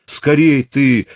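put-on old father barks at his daughter to hurry, loud and slow; sharp shout. A narrator's reading. Старик торопит дочь с письмом, резко прикрикивает. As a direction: bite out the order, impatient. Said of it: "прикрикнул Пантелей Прокофьевич".

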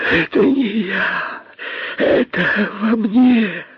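high, drawn-out terrified wail; horrified confession. A narrator's reading. Косой confesses to the python, horrified. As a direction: plead innocence in a high, stretched voice. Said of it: "в ужасе признался Косой".

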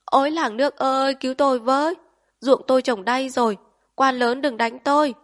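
high, loud pretend-terrified cry for help; panicked wail. A narrator's reading. Old woman faking terror before the Japanese officer, shrill wail. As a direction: shriek for the village to save her, hammed-up. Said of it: "kêu thất thanh".

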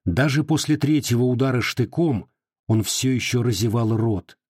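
ordinary delivery

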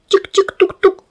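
high little tapping sound tik-tik-tuk-tuk; imitated knocking. Narrator imitating a girl knocking on the window, tapping sound. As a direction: mimic a small fist rapping on glass.

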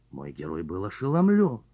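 ordinary delivery

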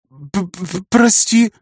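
stammering, shaky apology, high; trembling voice. Acted. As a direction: stutter it out, voice trembling. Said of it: "дрожащим голосом сказала она".

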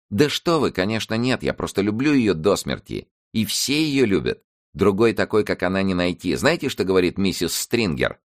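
put-on lively girl, high and bright; eager young girl. A narrator's reading. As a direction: gush about your friend like a young girl. Narrator voices girl Jolene.

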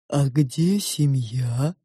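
slow, drawn-out sow voice; probing pig. A narrator's reading. The pig judges the girl's drawing, probing. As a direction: state it slowly, stretching every word.

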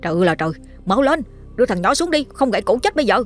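rising high and fast, urgent cry; alarmed shout. Acted. Villager spotting the boy in the bamboo, alarmed and high. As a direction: call out in alarm, pointing up. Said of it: "có một chú vừa la lên".

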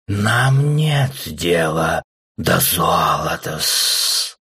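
very slow hissing snake; slow hypnotic serpent hiss. Acted. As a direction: stretch every word like a snake. The serpent replies to the lord.

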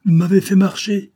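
high loud outburst; crying out. A narrator's reading. Coquentin realizes the sailors fooled him, loud cry. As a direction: burst out, high and loud, caught out. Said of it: "s'écria Coquentin".

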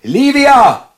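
lauter Ruf. Acted. George yells for his wife.

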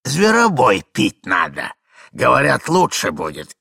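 put-on forgetful old man. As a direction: ramble on like a doddering old husband. Old man talks about his remedy.

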